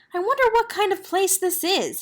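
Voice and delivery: high, nervous wondering. A narrator's reading. Girl at a strange door, nervous and high. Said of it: "said Helen nervously".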